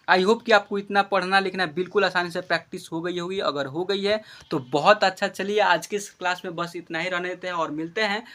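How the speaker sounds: usual speaking voice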